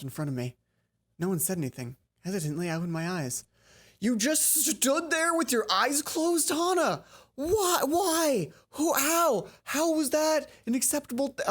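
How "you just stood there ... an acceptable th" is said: high, loud incredulous ranting; can't believe what she just did; exasperated disbelief. Real, not acted. To Hana on screen, incredulous loud rant. Player rants at character's odd behavior.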